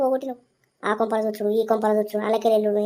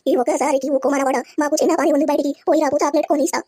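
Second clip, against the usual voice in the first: put-on higher, louder coaxing to the kid; coaxing shout; 7 semitones higher, 4 dB louder than usual. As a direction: bribe the grandson out with chocolate. Shooing the grandson out.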